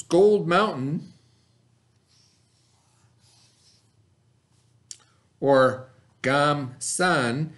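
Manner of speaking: Gold Mountain and Gam San said slowly and deliberately; slow careful naming. Shown as a demonstration. Teacher introducing the Chinese name to her students, slow and careful. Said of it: "as the Chinese called it".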